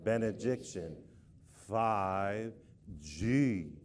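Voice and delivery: low, solemn, measured chant; somber and serious. Shown as a demonstration. Leading the congregation in a somber, serious chant. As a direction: intone each word gravely, pitched well down. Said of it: "somber and serious form and fashion".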